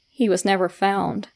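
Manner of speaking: plain reading voice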